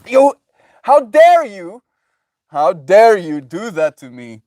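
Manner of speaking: high, loud, slow indignant cry; hammed-up shrieking fury; mock outrage. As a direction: hurl the accusation, scandalised. Acting out anger at someone.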